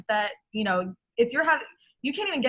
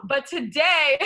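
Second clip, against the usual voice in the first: ordinary delivery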